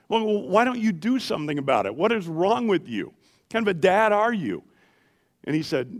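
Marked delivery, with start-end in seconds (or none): 0.1-4.6 higher, louder indignant questions; mock outrage at a fellow dad; retold exasperated challenge. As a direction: scold a friend you think is careless. Arguing with friend about son.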